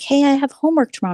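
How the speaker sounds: put-on lightly high kid voice; mock kid voice. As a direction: chirp it like a young teen. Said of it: "they were like".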